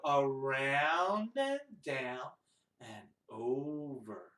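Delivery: slow, low, stretched words; drawn-out tracing. Shown as a demonstration. Teacher guiding children tracing a two, slow drawn-out words. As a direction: stretch each word to match a drawing stroke. Voicing finger tracing a number.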